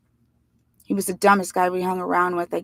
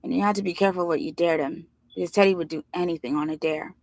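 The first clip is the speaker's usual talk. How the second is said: plain reading voice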